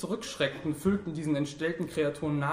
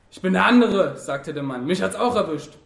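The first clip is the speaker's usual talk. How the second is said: loud, raised cry from the wounded man; calling out; 4 semitones higher, 8 dB louder than usual. A narrator's reading. Wounded man calls out to passer-by, calling loudly. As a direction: call it out, higher and forceful. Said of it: "Plötzlich rief jemand".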